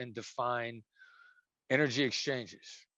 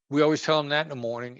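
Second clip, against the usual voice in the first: normal talking voice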